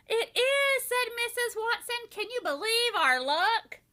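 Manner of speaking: wife boasting of her luck; thrilled. A narrator's reading. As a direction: gush with joy. Wife thrilled about piglet.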